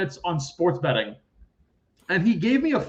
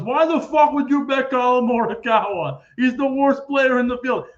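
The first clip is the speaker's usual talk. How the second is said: higher, louder mock indignant rant; mock outrage; 7 semitones higher, 5 dB louder than usual. [name] mocking Steve's golf bet, imitated outraged scoff. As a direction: scoff at the pick as if insulted.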